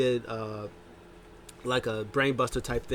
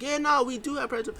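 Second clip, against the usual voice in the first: much higher, sing-song put-on voice; mock friendly; 14 semitones higher than usual. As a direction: fake nice, luring him in. Fake-friendly quote.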